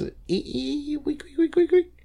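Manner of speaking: very high squeaky wheel sounds; squeaky tricycle noise. Retold or imitated. The little guy riding away, very high squeaks.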